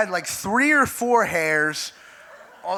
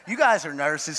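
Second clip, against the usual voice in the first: ordinary delivery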